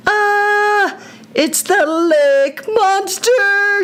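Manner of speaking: high, slow, stretched-out shout of alarm; frightened shout. A narrator's reading. Nick shouting to Brigham at the lake, frightened shout. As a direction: cry out the monster's name in panic. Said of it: "shouted Nick".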